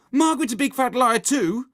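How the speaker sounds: put-on high, loud child's taunt; tattling accusation. A narrator's reading. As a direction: tell on your friend loudly. Susan tells on Margaret, quarrel.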